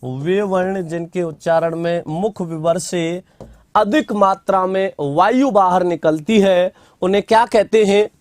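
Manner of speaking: slow, measured dictation with long pauses. Acted. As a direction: spell the definition out for students to write down. Dictating a definition. (धीमे-धीमे लिखवाते हुए)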